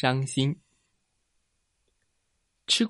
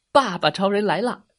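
proud, slightly high hero announcement; heroic entrance cry; 4 semitones higher, 3 dB louder than usual. A narrator's reading. Daddy Superman comes to save the potatoes, heroic call. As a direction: announce the hero with some flourish.

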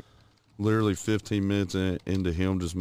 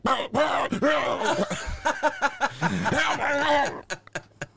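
high squealing laugh; high shrieking laugh. Acted. Wife's reaction acted.

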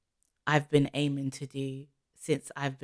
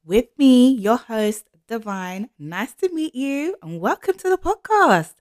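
louder, higher bright welcome; energetic host opening; 7 semitones higher, 13 dB louder than usual. Acted. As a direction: greet the listeners with big energy.

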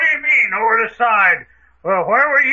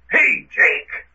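calling out; 4 semitones higher than usual. Acted. Calling for an absent accomplice.

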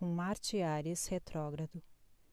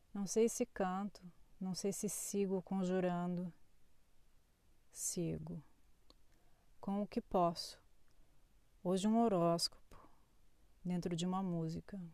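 poetry reading; 3 dB quieter than usual. Acted. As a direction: read it like a spoken poem. Reads a poetic horoscope.

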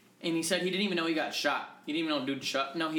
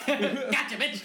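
laughing quote; 9 semitones higher, 5 dB louder, much slower than usual. Retold or imitated. Friend replays the quote laughing.

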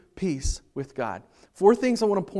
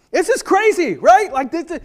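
much higher and louder, disbelieving; incredulous outburst; 10 semitones higher, 10 dB louder than usual. Real, not acted. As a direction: hit them with the disbelief.

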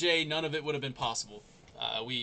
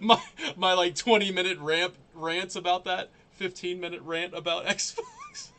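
laughing while talking; 5 semitones higher, 7 dB louder than usual. Real, not acted. Laughing at own mix-up.

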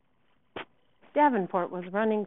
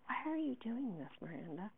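soft, puzzled asking; hushed question; 4 semitones higher, 9 dB quieter than usual. Acted. Friend asking Miranda her reason, hushed. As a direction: ask it gently, almost under the breath.

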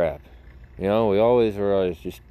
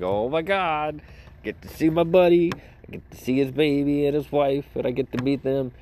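higher, thrilled inner voice; excited gushing; 6 semitones higher than usual. Retold or imitated. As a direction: gush like a kid about meeting the buddy's family. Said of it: "I'm like".

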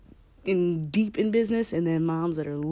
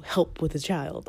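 normal talking voice

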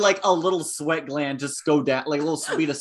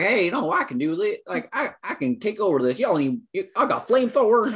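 higher, hammed-up southern accent; country accent; 6 semitones higher than usual. Mimicking the movie villain for friends, in a mock southern accent. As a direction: play the villain as a mock southern good ol' boy, pitched up. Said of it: "He does a country accent".

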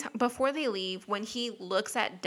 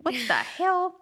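high, laughing what the hell; laughing disbelief; 8 semitones higher than usual. Real, not acted. Reacting to a creepy line in the plot, laughing. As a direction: laugh it out in shock.

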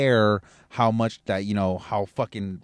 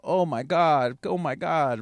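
mock complaining; 4 semitones higher than usual. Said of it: "Everybody complains about".

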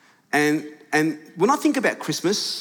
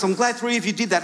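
ordinary talk

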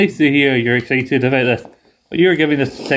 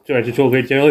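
normal talking voice

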